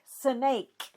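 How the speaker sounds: "snake" said as "suh-NAKE"; snake in two syllables. Shown as a demonstration. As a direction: break "snake" after the s. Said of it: "two syllables in snake".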